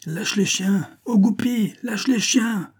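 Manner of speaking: very high, urgent hunting cry; high carrying shouts; shouting from afar. A narrator's reading. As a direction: call the order across the field. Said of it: "lui cria de loin le valet".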